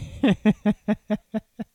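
high, loud burst of laughter; real laugh. Real, not acted. Husband laughing at wife's whole experience, loud laugh.

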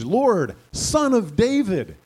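high, loud slow cry; declaring. Acted. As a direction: proclaim him with urgency. Blind men declaring.

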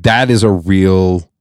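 ordinary delivery

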